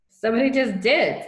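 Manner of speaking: loud, high splutter. A narrator's reading. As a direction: burst out as if fighting giggles. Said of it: "spluttered Patrick".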